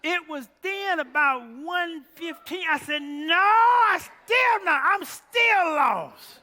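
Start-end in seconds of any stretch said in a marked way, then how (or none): 3.0-6.4 slow, loud, high shouted reply; loud lament. Retold or imitated. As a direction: drag out a loud wail. Retold reply to friend.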